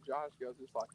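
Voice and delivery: ordinary talk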